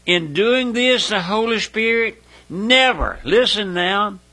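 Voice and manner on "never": "never" lifted high and drawn out; emphatic never. Real, not acted. Preacher underlining what the Spirit does, emphatic high "never". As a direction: lean hard on "never", pitched up. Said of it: "Underline that".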